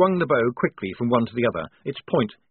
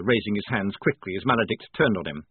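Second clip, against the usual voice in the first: plain reading voice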